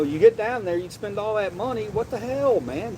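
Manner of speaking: ordinary talk, nothing marked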